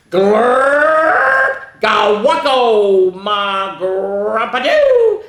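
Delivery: loud, stretched gibberish swooping up; silly sounds. A narrator's reading. Reader forced to make silly sounds, loud drawn-out gibberish. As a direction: belt out slow, rolling nonsense. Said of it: "make silly sounds like".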